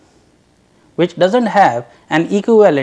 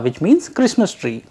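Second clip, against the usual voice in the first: ordinary talk, nothing marked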